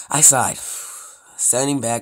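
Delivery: normal talking voice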